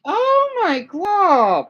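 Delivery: high, drawn-out incredulous gasp; can't believe what she's hearing; exasperated disbelief. Real, not acted. Outraged reaction to absurd claim.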